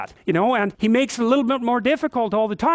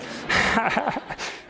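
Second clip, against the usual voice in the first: soft breathy laugh; 3 semitones higher, 4 dB quieter than usual. Real, not acted. Laughs at his own joke.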